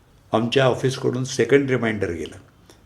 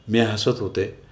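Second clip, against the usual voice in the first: ordinary delivery, nothing marked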